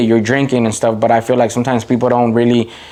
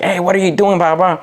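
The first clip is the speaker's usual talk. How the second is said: higher-pitched mock telling-off, not a real shout; retold mock yell; 7 semitones higher than usual. Dad catching his child at baseball, retold mock yell. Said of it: "he starts yelling like".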